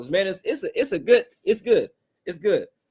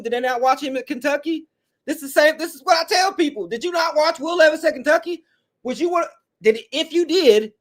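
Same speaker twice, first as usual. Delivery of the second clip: exasperated, raised-pitch questioning; worked-up rant; 8 semitones higher than usual. Real, not acted. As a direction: pitch climbing high in disbelief. Ranting about Will Levis at Kentucky.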